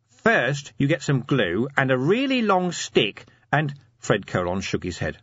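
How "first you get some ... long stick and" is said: high-pitched, eager scheming corporal; eager schemer Nobby. Acted. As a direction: pitch a crafty plan, voice well up. Nobby explains his scheme.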